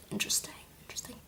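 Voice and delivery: fast secret whisper. Real, not acted. Vlogger hinting at the next book in a whisper. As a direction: breathe it like a teasing secret.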